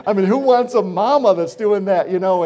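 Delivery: loud, high, laughing through the words; laughing while talking. Real, not acted. Preacher still laughing telling congregation about mom.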